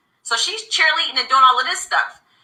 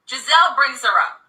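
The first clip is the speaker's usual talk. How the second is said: ordinary talk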